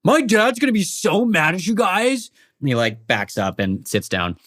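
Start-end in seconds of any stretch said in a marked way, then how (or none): 0.0-2.3 high, whiny threat of a spoiled brat; bratty sulking kid. Acted. Raider sulks in cell.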